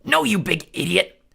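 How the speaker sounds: slow, loud, high shout; bellowed insult. Acted. Clarice bellowing at the dim Cyclops. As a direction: bellow it at him. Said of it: "bellowed Clarice".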